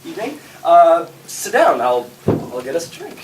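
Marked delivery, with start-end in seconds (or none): none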